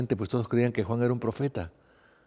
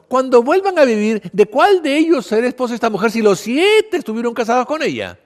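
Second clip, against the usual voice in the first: argumentative Sadducee; 8 semitones higher, 5 dB louder than usual. Acted. Sadducees challenging Jesus on resurrection, theatrically argumentative. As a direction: spin out the puzzle with big pitch leaps.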